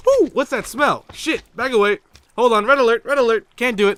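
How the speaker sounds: loud, high comic panic; mock alarm. Acting a passer-by hit by the bush's smell, mock alarm. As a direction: sound the alarm, high and urgent.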